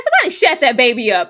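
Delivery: snapping at a crying baby, hammed up; mock yell. Watching the show, yelling at the crying baby in mock annoyance. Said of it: "I said".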